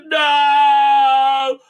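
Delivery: loud, high, drawn-out wail; mock anguished scream. Mocking her cry as it blows up, hammed-up scream. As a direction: scream it long in melodrama.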